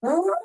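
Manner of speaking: big huffing dinosaur breath out. Shown as a demonstration. Grandmother showing her calming trick, a big huffed breath. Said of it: "I just go".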